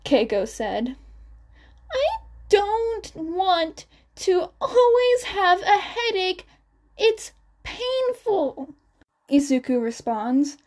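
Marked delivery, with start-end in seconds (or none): 1.9-8.8 put-on a hurting kid close to crying; tearful child. A narrator's reading. Sick child complains of headache.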